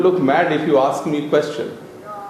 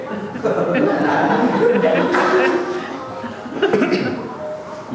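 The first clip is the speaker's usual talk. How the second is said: breathy, high laughter that rolls on; long airy laugh; 12 semitones higher than usual. Real, not acted. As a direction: keep laughing, amused at yourself. Laughing at his own joke.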